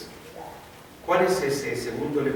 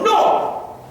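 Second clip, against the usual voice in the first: loud, high, forceful no; resounding, resolute refusal; emphatic refusal; 9 dB louder than usual. Acted. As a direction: refuse it firmly at high volume. Acted firm refusal of temptation.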